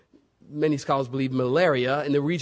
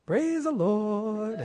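slow, soft, high satisfied "Praise the Lord"; smug mock praise; 5 semitones higher, 4 dB quieter, much slower than usual. Paul watching the riot he started, slow and smug. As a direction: savour it like you got away with it. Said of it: "And Paul's like".

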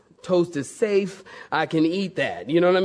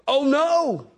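high-pitched, desperate cries; panicked shouting; 10 semitones higher, much slower than usual. A narrator's reading. Rider nearing cliff edge, shouting in panic. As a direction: fire off frantic guesses at a magic word. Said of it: "shouted the man".

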